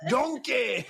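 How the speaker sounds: high hammed-up shout of Donkey!; Shrek impression. Calling out to his sidekick as Shrek, hammed up. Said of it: "he just sounded like Shrek".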